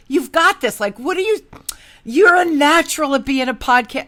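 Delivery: high, loud, emphatic encouragement; acted-out insistent reassurance; retold pep talk. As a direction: cheer a friend on forcefully.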